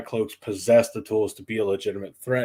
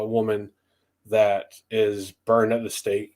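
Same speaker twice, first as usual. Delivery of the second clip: usual speaking voice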